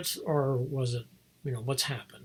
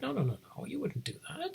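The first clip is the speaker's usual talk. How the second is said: high, softer childlike insistence, lightly voiced; girl insisting; 12 semitones higher, 6 dB quieter than usual. The girl correcting the storyteller's plot, high and soft. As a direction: correct him like a stubborn little girl. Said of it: "and she's like".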